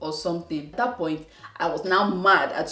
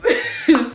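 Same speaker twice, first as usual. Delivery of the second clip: brief burst of laughter; a quick real laugh; short laugh; 8 semitones higher, 8 dB louder than usual. Real, not acted. Laughing at her own frustration, a short real laugh.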